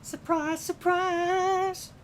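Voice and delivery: high sing-song Scouse catchphrase; high, drawn-out showbiz Liverpool reveal. Retold or imitated. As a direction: sing it out like a TV show host springing a reveal.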